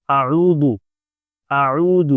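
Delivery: اعوذ with a full throaty ayn at the start; ayn pronounced clearly. Shown as a demonstration. Teacher demonstrating اعوذ, clear throaty ayn. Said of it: "آئین کو بھی برابر سے نکالنا ہے".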